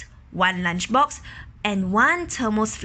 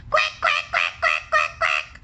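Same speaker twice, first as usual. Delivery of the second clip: quacking ducks. Acted. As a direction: quack like a flock of ducklings. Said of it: "brothers and sisters quacked and quacked".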